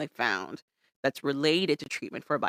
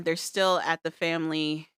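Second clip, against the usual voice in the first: ordinary talk, nothing marked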